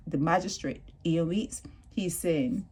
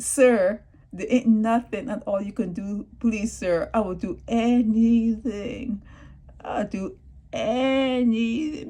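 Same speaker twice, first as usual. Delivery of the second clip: put-on pleading boy; 5 semitones higher, 5 dB louder than usual. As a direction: plead like a desperate teen. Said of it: "And then he said".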